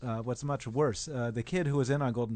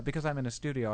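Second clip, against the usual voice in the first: ordinary talk, nothing marked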